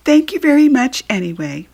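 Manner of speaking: the polite little puppy chirping thanks. Acted. Narrator voicing the puppy thanking everyone, cheerful and loud. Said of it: "Pokey said".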